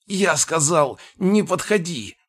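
high, sharp angry yell; angry shout. Acted. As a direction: snap it out as a furious warning. Gnome warns him off angrily.

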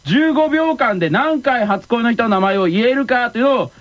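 ordinary talk

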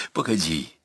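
low, soft request; subdued, falling plea. A narrator's reading. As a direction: ask quietly for a moment. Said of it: "попросила Марфа".

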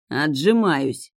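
plain reading voice

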